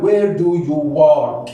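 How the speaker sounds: slow, drawn-out question for learners; teacher's slow demonstration; slow modelling. Shown as a demonstration. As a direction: model each word slowly.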